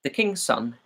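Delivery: ordinary delivery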